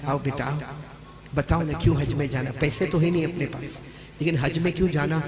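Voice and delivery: soft, low loving mother; tender mother. Retold or imitated. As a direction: coax the child gently. Mother softens, loving now. (प्यार से बुलाती माँ)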